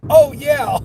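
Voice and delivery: high, loud mock "now I remember"; retold sudden realization. Customer remembers her DoorDash order, loud mock realization. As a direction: imitate the customer's sudden recall.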